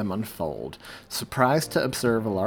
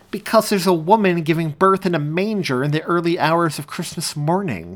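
high and louder, eagerly; eager insistence; 6 semitones higher, 7 dB louder than usual. A narrator's reading. Cameraman urging his reporter partner, eager. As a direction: push the obvious point with glee.

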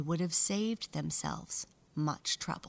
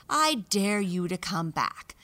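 loud, bold taunting challenge; taunting dare; 2 semitones higher, 7 dB louder than usual. Acted. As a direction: dare them boldly. Boastful mouse dares siblings.

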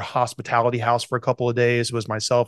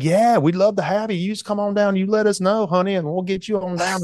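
put-on higher, warm Southern Georgia accent; 7 semitones higher than usual. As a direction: play the friendly Georgia host inviting him down, in a Georgia accent. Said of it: "my Georgia accent".

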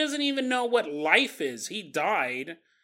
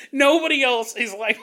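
ordinary delivery

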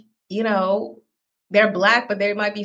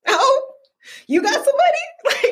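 very high-pitched imitation of sudden interest; mock intrigued surprise. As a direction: squeal it lightly as a nosy question. Mimics people discovering she has money.

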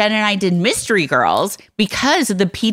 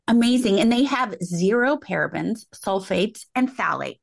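softer sponsor read; 6 dB quieter than usual. Real, not acted. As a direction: read the makeup copy gently to listeners. Reads a sponsor ad.

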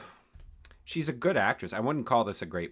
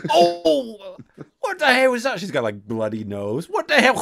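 shrieking surprise; 13 semitones higher, 8 dB louder than usual. Retold or imitated. Shocked reaction to scene.

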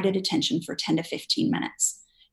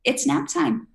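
put-on bright, higher, upbeat promise to a toddler; cheerful reassuring parent; 5 semitones higher than usual. Parent cheerfully telling toddler it's nap time, bright and upbeat. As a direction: sell the nap like it's a treat. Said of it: "Or you're like".

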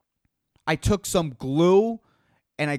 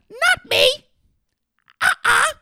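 falsetto "not me"; high squeaky denial; 5 dB louder than usual. Acted.

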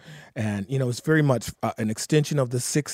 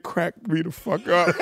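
ordinary talk, nothing marked

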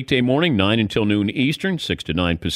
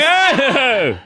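shout; 13 semitones higher, 5 dB louder than usual. Real, not acted. Bursting out laughing at caller.

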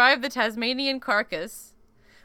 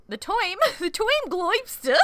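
giggling through a silly mangled word; laughing mispronunciation; 11 semitones higher, 4 dB louder than usual. Pretend. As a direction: laugh while saying it wrong on purpose. Playful mispronunciation of term.

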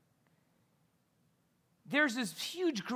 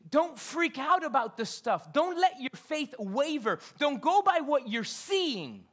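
higher, louder, slow pleading; Jesus urging disciples, louder, slow; preached Jesus quote; 6 semitones higher, 5 dB louder, slower than usual. Retold or imitated. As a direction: urge them not to waver. Voicing Jesus reassuring disciples.

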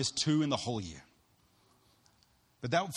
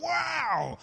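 amazed wow; 4 dB louder than usual. Retold or imitated. Amazed by the book.

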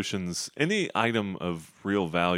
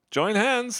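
high, loud wizard command; old wizard voice; 13 semitones higher, 4 dB louder than usual. Acted. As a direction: call for hands like an old wizard. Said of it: "the old man, talking Gandalf-like".

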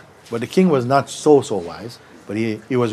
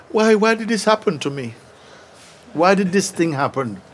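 whining king; 7 semitones higher than usual. Retold or imitated. King laments his injury.

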